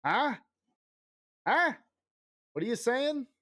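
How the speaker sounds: husband imitating himself not hearing his wife; confused deaf huh. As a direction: play a half-deaf man straining to hear. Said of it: "and I'm like".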